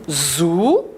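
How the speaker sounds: ZOU held long, z into ou; syllable blending. Shown as a demonstration. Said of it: "Le son ou".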